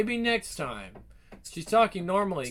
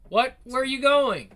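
alarmed shout; 7 semitones higher, 6 dB louder than usual. Acted. Left tied up, calling after him.

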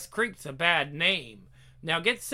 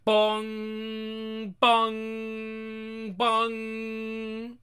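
bell imitation; 3 semitones higher, much slower than usual. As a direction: imitate a tolling church bell.

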